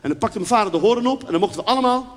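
ordinary talk

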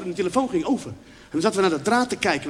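ordinary talk, nothing marked

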